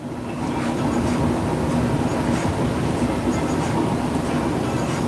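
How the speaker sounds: breathless airless laughter; silent wheezing laugh. Real, not acted. Host losing it over a chat comment, wheezing silent laugh. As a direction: laugh until no sound comes. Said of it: "I'm literally crying over here".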